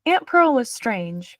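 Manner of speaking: ordinary delivery, nothing marked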